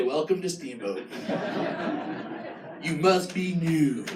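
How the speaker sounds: low, soft deadpan welcome; dry deadpan. Retold or imitated. Comic greeting a newcomer with deadpan irony. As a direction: say it flat and knowing. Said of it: "we just say".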